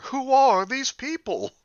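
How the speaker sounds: lightly voiced Seinfeld-style question, high and slow; a hint of a Seinfeld bit; Jerry Seinfeld. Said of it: "I'm just gonna go full Jerry Seinfeld here".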